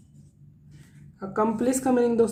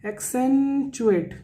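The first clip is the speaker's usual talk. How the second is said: Accentuate drawn out slowly and higher; stressed word; 4 semitones higher, much slower than usual. Shown as a demonstration. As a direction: lean hard on accentuate, stretching it. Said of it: "आपको जोर देना पड़ा".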